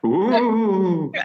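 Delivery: high wavering ghostly ooh. Pretend. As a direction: moan it like a ghost. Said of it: "That was an impression of a ghost".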